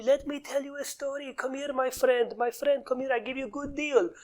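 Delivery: put-on high-pitched, wheedling Persian-accented salesman. Seller coaxes a friend with a deal, high Persian accent. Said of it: "They talk it like this".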